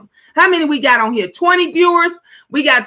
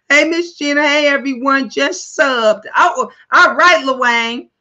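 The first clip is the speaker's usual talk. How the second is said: normal talking voice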